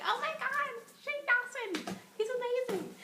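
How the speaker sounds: high squealing fangirl gush; very high excited gushing. Retold or imitated. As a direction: squeal like a starstruck fan.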